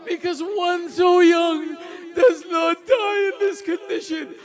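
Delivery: high, loud, wailing sermon climax; lamenting preacher crying out to mourners; impassioned preaching. Acted. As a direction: pour grief out at full force over the crowd.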